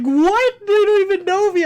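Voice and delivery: high, loud incredulous protest; laughing disbelief, imitated. Friend baffled by strangers' goodbyes, loud disbelief. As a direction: re-enact a friend's baffled amusement. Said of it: "laughing. You were like".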